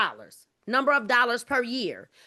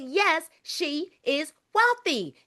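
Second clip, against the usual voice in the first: usual speaking voice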